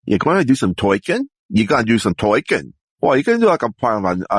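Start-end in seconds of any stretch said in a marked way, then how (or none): 0.1-2.7 broad New York vowels, "toykin" for talking; heavy New York accent, challenging question. Shown as a demonstration. Performer demonstrates talking in a New York accent. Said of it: "a New York, uh, accent".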